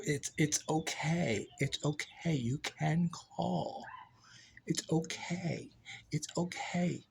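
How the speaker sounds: quiet, slow, soothing repetition; soft reassurance. Real, not acted. Telling someone they can call, soft reassurance.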